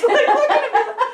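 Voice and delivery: the cat complaining about all her wasted work, high and loud; cat's indignant rant. Retold or imitated. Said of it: "She's like".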